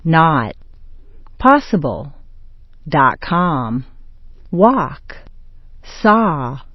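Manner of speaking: "Not", "Possible", "Dot com", "Walk", "Saw" all with a dropped-jaw ah; open ah vowel. Shown as a demonstration. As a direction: separate demo words, each vowel an open ah. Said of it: "Drop your jaw and say ah".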